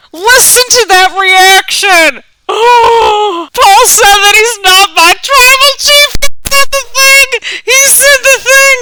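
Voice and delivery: very high, loud, slow near-crying shouts; overwhelmed fan, voice cracking with feeling; emotional outburst. Real, not acted. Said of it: "I'm getting a little emotional".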